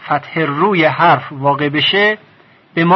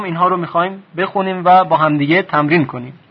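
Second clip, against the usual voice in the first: ordinary talk, nothing marked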